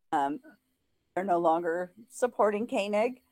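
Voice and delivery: ordinary talk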